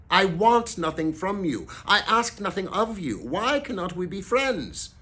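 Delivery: high-pitched pleading; earnest appeal. A narrator's reading. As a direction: appeal to him with voice lifted. Nephew appeals for friendship.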